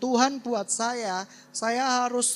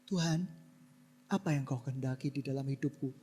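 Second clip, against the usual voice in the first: soft, lower, quick and humble; humble prayer; 8 semitones lower, 10 dB quieter, faster than usual. Pretend. Modelling the right prayer to the church, soft and humble. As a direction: pray it quietly, surrendered. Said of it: "doa dulu".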